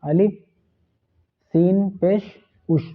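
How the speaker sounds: ordinary delivery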